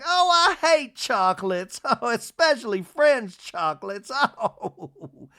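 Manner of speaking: high, loud, slow mock distaste with laughter; hammed-up society matron. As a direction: imitate a rich lady laughing theatrically. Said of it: "and she's like".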